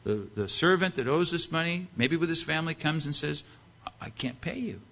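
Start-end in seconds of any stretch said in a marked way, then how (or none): 3.9-4.8 soft, halting confession; meek admission. Acted. Servant before the king admitting he cannot pay, meekly. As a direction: own up quietly and hesitantly.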